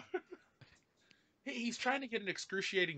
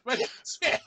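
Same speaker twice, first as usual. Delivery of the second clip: high, loud mock movie line; hammed-up meme quote; 12 semitones higher, 5 dB louder than usual. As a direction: belt the meme line as a punchline. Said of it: "That's how I know".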